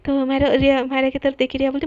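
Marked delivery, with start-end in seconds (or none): none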